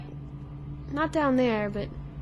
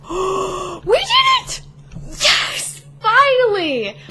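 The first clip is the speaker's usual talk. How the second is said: gasp, then high, loud drawn-out cheer; real relieved victory yell; triumphant; 8 semitones higher, 6 dB louder, much slower than usual. Real, not acted. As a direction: shout it out as if finally through. Celebrates finishing the climb.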